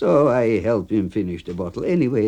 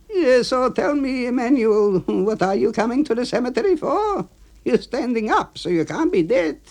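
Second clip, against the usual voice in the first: much higher, louder joking voice; teasing A B; 4 dB louder than usual. Acted. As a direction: tease him from up high.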